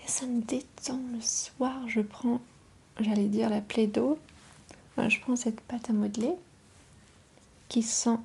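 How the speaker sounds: voix chuchotée douce et proche; chuchotement ASMR. Acted. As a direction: chuchoter tout près du micro. ASMR whisper with play-dough. (murmure ASMR)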